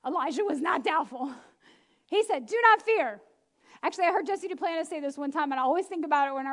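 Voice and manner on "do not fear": higher, louder firm reassurance; firm command. Acted. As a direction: do not fear said loud and higher. Elijah's command repeated.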